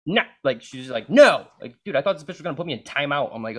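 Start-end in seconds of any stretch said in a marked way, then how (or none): 0.1-0.2 mock scolding bark; retold sharp refusal. 1.1-1.5 loud, high mock scolding; retold yelled refusal.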